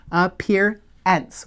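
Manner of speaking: Appearance said very slowly, each sound drawn out; slow stretched word. Shown as a demonstration. Teacher modelling the word slowly for spelling. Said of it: "What are the sounds in ence?".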